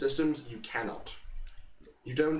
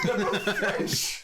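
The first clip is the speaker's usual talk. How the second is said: laughing; 8 semitones higher, much slower than usual. Real, not acted. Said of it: "You should have said it like him".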